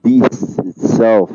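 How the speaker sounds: normal talking voice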